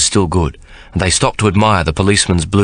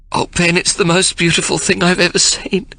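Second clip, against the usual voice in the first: much higher, tear-choked words; 12 semitones higher than usual. A narrator's reading. Sarah crying over the ring, choked with tears. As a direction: fight back sobs through it. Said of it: "she chokes".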